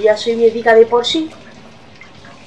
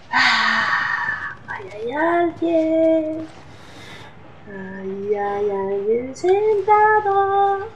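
scared; 4 semitones higher, 7 dB quieter, much slower than usual. Real, not acted. Spots a figure in the dark.

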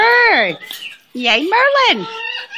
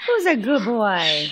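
low, soft and tender; 8 semitones lower, 4 dB quieter than usual. Real, not acted. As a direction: coo it gently to the bird. Praising pet bird.

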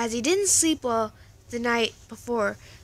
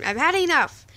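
loud, higher furious yell; screaming; 5 semitones higher, 7 dB louder than usual. Acted. Jack standing up to the bullies, loud and fed up. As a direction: shout that you're done. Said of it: "Jack screamed".

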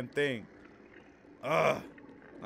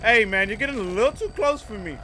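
loud, high-pitched warning; alarmed protest; 10 semitones higher, 10 dB louder than usual. Real, not acted. Player with an enemy too close, protesting loudly. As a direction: raise your voice to back someone off.

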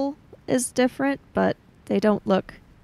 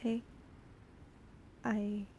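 faltering hush; 16 dB quieter than usual. Acted. Chiaki falters quietly.

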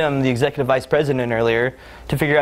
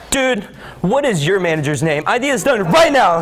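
exasperated outburst; 6 semitones higher than usual. Acted. Executive pressuring help desk over lost time, acted angry outburst.

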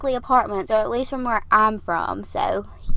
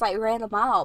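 ordinary delivery

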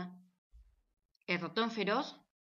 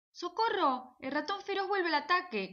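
high frightened shouting; cry for help; 9 semitones higher than usual. Acted. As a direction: scream for help, running home. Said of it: "gritaban las amigas".

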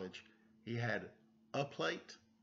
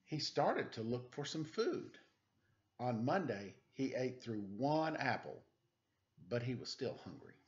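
slow, gentle picture-book read-aloud; reading to children; 2 semitones higher than usual. Acted. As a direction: read it like a bedtime story for little kids.

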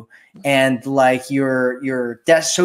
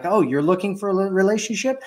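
higher-pitched, quick questioning quote; lightly acted startled question, rising at the end; mock surprise; 6 semitones higher than usual. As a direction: play a man checking, mildly taken aback. Imagined startled man reacting to her.

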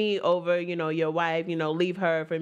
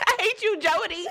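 crying laugh mock; 11 semitones higher than usual. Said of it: "She's like".

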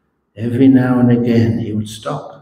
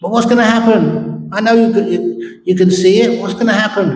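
high and louder, urgent; eager pressing; 10 semitones higher, 3 dB louder, faster than usual. Retold or imitated. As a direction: push for the answer impatiently. Man pressing for his future.

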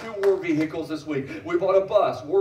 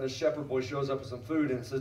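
ordinary talk

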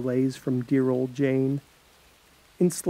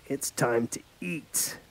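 soft, slow, menacing murmur; sinister hush; 7 dB quieter than usual. Acted. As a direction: let the threat sink in quietly. Mortician's sinister threat.

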